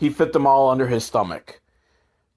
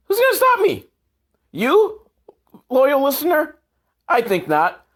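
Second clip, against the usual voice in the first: much higher, cartoonish taunting voice; mock challenge; 11 semitones higher than usual. Podcaster dares his loyal listener to stop him, high and hammy.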